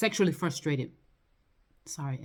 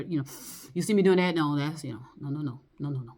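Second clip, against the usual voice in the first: ordinary talk, nothing marked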